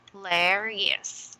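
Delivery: slow "hilarious", split into its syllables; broken into syllables. Shown as a demonstration. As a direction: sound out "hilarious" piece by piece for a learner. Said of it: "syllabicate this word".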